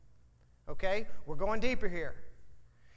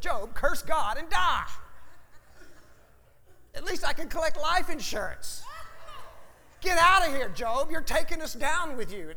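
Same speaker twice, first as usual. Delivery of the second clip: nagging wife; 5 semitones higher than usual. Retold or imitated. Preacher voicing Mrs Job berating Job, mock nag. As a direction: ham up the scolding wife. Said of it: "the sweetheart that she was, said".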